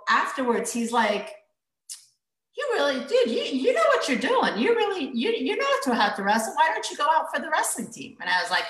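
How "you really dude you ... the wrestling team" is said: high, swooping imitated amazement; impressed disbelief. Wrestler urging her to join the team, high impressed astonishment. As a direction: imitate a rival amazed at your skill.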